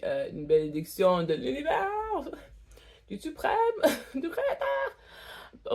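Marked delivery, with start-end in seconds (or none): 3.1-5.7 high giggling through the words; laughing. Real, not acted. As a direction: laugh while saying it. Laughing at her own joke.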